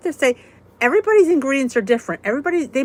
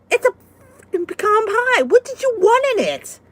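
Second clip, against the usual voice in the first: pitched way up in hammed-up outrage; mock indignant retort; 12 semitones higher, 4 dB louder than usual. Retelling her comeback to the commenter, high mock outrage. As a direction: fire it back high, like a mock scandalised reply. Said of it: "I know, I went".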